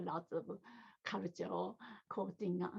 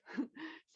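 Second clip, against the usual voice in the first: short chuckle; 8 semitones higher than usual. Real, not acted. Teacher chuckles at own word "guts" while guiding meditation, short light laugh. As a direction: let out a small real laugh mid-sentence.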